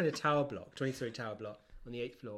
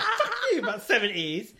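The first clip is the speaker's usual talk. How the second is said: loud, higher, laughing indignation; playfully offended protest; hammed-up furious laughing retort; mock outrage; 8 semitones higher, 17 dB louder than usual. Real, not acted. Mock outrage at age jab.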